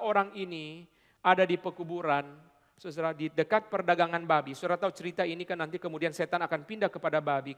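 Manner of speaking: normal talking voice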